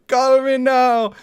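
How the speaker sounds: high, loud, slow infomercial catchphrase; drawn-out late-night hotline pitch; Miss Cleo imitation. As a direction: stretch it out like a TV psychic. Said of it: "I love how she said that".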